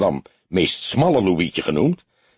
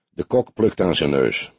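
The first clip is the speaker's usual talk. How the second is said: ordinary delivery, nothing marked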